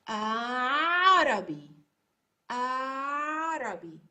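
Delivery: slow Arabi, throat catch after the first vowel; Arabi with Ain. Shown as a demonstration. Teacher modelling the word for students, slow and throaty. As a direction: stretch the vowel and choke it into Ain. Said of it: "So let's try".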